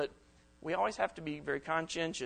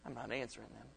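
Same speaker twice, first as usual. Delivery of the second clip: lower, much softer, sullen resolve; spiteful mutter; 5 semitones lower, 11 dB quieter than usual. Retold or imitated. Speaker voicing someone refusing to reply, soft sullen mutter. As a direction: say it sullen, barely aloud.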